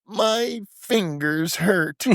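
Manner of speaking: high, drawn-out complaint; whiny movie quote. Acted. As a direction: stretch it out high like a feeble whine. Acting out a quoted movie scene.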